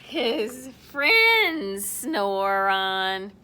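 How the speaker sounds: very slow, loud, sinking low; drawn-out refrain. A narrator's reading. Storyteller reveals the friends now sleep, drawn out. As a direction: stretch it out and sink down.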